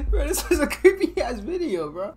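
giggling sign-off, cracking up; laughing. Real, not acted. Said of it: "I can't keep a straight face".

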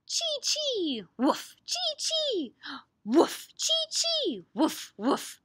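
very high squeals against loud woofs; loud, high animal chatter; squirrel and puppy noises. Acted. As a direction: alternate high squirrel chees with puppy barks. Voices squirrel and puppy in story.

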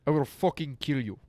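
mock threat in a Russian accent. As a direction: play a Russian tough making threats.

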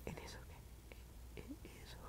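barely audible whisper. Real, not acted. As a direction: whisper it under your breath. Murmurs aside to self.

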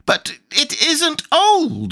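very high, drawn-out tantrum cry; furious protest. A narrator's reading. Tweedledum defending his rattle, high furious protest. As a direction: stretch it out in a high wail. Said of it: "cried in a greater fury than ever".

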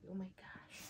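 breathless whispered gasp; whispered oh my gosh over the gift. Real, not acted. Unboxing her Valentine's gift, whispering in awe.

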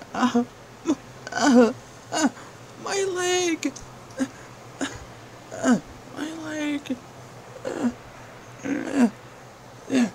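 high yelps of pain; pained cries. Acted. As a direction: cry out, leg is hurt. Villain in pain, leg hurt.

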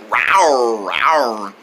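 low falling tiger rawrs; tiger roars. Acted. Tiger fan imitating one, low roars.

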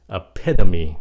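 EPITOME with a loud punched "PID"; American D sound. Shown as a demonstration. Teacher drills the American reading, loud EPITOME with a D. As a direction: hit EPITOME loudly as "e-PID-a-mee". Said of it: "âm D".

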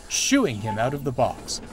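plain reading voice

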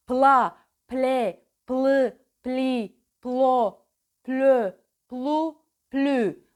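every syllable pushed out with big lip movement; exaggerated syllables. Shown as a demonstration. Diction coach exaggerating pla ple for students. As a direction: overdo the mouth on each pla, ple, plı. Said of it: "Hatta çalışırken abartın".

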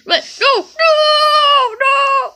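high, pleading no no no; panicked refusal. Acted. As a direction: beg not to go, voice shooting up. Refusing to go to dentist.

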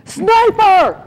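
sudden high yell of alarm; shouting a warning. Retold or imitated. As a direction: bellow it out to warn the squad. Spotting a hidden sniper in training.

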